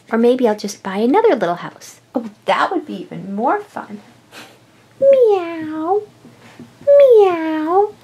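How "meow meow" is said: high, drawn-out cartoon meows; cat meowing. Pet cat arrives at the new house, meowing. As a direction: meow like a cat announcing itself.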